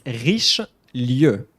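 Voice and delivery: "Richelieu" drawn out as "reesh-LYUH"; slow French demo. Shown as a demonstration. Teacher showing the hardest name, slow French "Richelieu". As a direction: separate the ending of "Richelieu" slowly. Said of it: "but the end".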